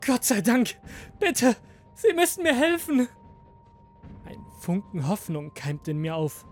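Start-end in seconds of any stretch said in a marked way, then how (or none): 0.1-3.1 high, urgent begging; desperate pleading. A narrator's reading. Prisoner begging the guard for help, desperate. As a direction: plead as if it's the only hope.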